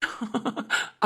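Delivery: quiet teasing laugh; soft chuckle. Acted. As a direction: laugh lightly under the breath. Caller teasing her, chuckles. (மெல்லிய கேலிச் சிரிப்பு)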